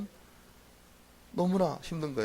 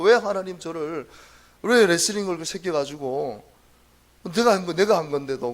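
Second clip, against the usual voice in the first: put-on a young pastor lamenting to God; loud plaintive lament; 2 semitones higher, 7 dB louder than usual. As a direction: drag out the grievance. Retold complaint to God.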